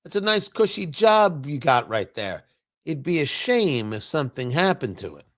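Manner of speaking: low, quiet mobster menace; veiled mob threat, soft and low; smiling threat. Retold or imitated.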